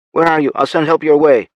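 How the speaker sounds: high, loud, pushing for an answer; eager stranger over the radio; urgent radio voice. Acted. Unknown radio voice.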